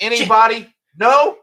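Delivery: high, loud one-word questions; mock incredulous prodding. Podcaster pressing Jamie who stays silent, high mock incredulity. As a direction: poke for an answer, voice way up.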